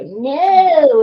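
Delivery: sudden shouted refusal; loud no. Pretend. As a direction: yell it like a crashing program. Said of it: "the editing software will just go".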